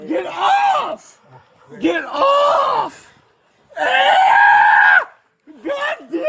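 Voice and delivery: desperate shouting. Real, not acted. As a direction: scream to be let go. Fights restraint, yelling.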